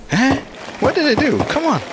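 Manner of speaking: high-pitched, baffled protest; exasperated surprise. Real, not acted. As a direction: exclaim in disbelief at your own mistake. Frustrated by own game mistake.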